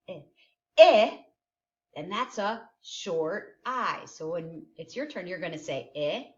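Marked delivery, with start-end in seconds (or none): 0.8-1.2 "Eh" loud and high; emphasized vowel. Shown as a demonstration. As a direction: punch out "Eh" sharply. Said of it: "Do you hear it?".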